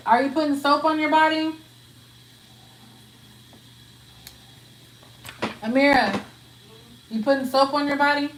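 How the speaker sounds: loud, high call to another room; mother calling down the hall. Real, not acted. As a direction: shout through the house to the daughter. Calling out to daughter Amira.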